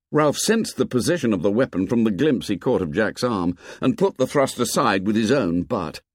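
plain reading voice

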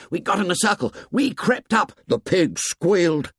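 put-on high, eager boys breaking in; excited hunters. A narrator's reading. Hunters breaking in excitedly to tell Ralph. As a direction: tumble out the story eagerly. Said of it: "Voices broke from the hunters".

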